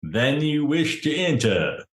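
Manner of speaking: enormous doorman's ceremonial challenge; intoning gatekeeper. Acted. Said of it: "enormous dude who's intoning at you".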